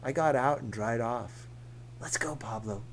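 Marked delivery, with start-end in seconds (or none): 2.0-2.8 whisper. Acted. Narrator urges leaving quietly.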